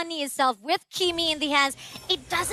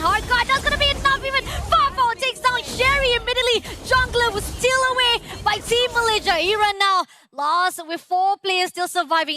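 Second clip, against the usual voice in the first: louder, higher, rushing hype; excited play-by-play; 6 semitones higher, 3 dB louder than usual. Real, not acted. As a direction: call the kills with excitement. Casting a fight, voice rises.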